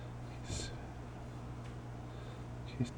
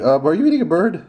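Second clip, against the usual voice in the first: chipmunk voice; 8 semitones higher, 5 dB louder than usual. Pretend. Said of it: "That's the chipmunk going".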